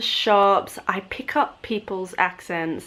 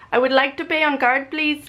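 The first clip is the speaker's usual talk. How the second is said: higher voice mimicking an Indian accent; copied Indian accent; 6 semitones higher than usual. Customer answering the cashier in a copied Indian accent. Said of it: "And then I go".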